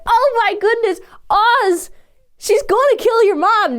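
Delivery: high, worked-up exclamation; alarmed warning. Retold or imitated. Viewer warning Oz his mother is in danger, high alarmed outburst. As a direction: warn him in mock panic. Retells own alarm, addressing Oz.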